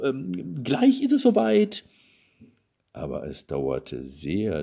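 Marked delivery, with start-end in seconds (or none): 0.6-1.9 high, loud call to another room. A narrator's reading. Couple calling to each other while searching, calling out. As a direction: sing out loudly so they hear you. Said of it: "riefen sich nur manchmal zu".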